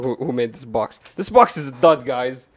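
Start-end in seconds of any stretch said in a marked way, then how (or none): none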